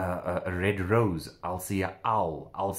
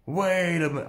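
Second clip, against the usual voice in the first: freaked out; 7 semitones higher than usual. Retold or imitated. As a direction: repeat it, pitched up, as the shock lands. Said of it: "I personally freaked out".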